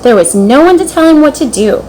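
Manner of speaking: plain reading voice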